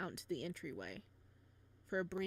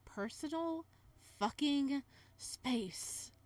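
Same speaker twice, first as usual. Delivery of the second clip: annoyed mutter; 4 semitones higher, much slower than usual. Real, not acted. Annoyed aside at character's kiss.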